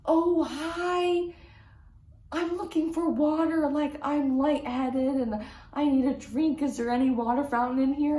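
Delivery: a hint of a frail, confused lady asking for water; elderly woman.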